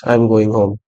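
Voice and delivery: low, soft, unemphasized statement; dead low tone. Shown as a demonstration. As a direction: drop it low and stress nothing. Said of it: "it's a dead low, uh, tone sentence".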